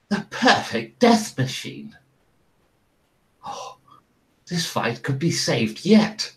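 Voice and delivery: awestruck lord, swooping up high; hushed gasp building to high, swooping delight; Lord Botherington Botherington Smythe. Acted. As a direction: marvel theatrically over a speaker. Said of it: "Lord Botherington Botherington Smythe go".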